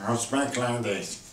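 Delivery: stuffed-up, closed nasal sound; nasal cold voice. Shown as a demonstration. Teacher showing the choir the no-sound, like having a cold. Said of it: "like when you have a cold".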